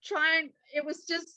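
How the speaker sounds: high-pitched, overwhelmed retelling; mock-tearful and high; retold bawling. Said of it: "bawling my eyes".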